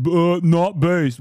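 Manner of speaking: slow, loud, dopey mock voice; mock dumb voice. Mocking Asmongold fans in a loud dopey voice. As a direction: drag it out like a dim fan.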